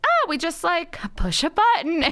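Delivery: high, airy mock-naive tone; lighthearted imitation of her old self; naive breeziness. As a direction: play the clueless optimist. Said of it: "thought induction was like".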